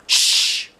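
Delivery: sharp whispered shush. A narrator's reading. The boy shushing Junie B in the library. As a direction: hiss a quick shh. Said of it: "The boy I can beat up said".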